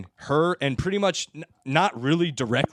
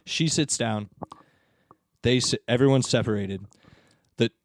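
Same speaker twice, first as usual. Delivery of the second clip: ordinary talk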